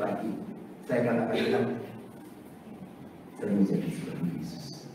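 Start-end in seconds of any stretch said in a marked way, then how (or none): 1.4-4.9 earnest quiet prayer. Retold or imitated. Quoted prayer.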